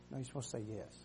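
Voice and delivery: hushed prompt to the volunteer; quiet aside. Real, not acted. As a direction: softly tell her what to say.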